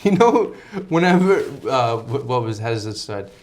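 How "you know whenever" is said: Tony quote. Retold or imitated. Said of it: "he goes".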